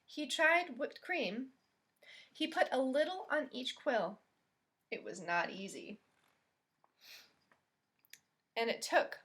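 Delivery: gentle, expressive storybook reading; picture-book read-aloud voice; reading to children. Acted. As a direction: read it like storytime for little kids.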